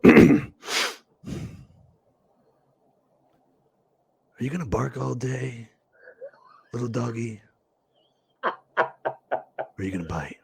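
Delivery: low, soft, menacing gangster taunt, slowing down; Mister Blonde impression. Shown as a demonstration. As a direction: coax it quietly like a sadistic gangster. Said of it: "we look inside the camera and we go".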